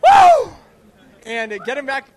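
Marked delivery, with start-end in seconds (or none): 0.0-0.5 very high, loud "woo"; crowd-rousing whoop; celebratory whoop. Real, not acted. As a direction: let out a piercing cheer. Cheering the winner's interview.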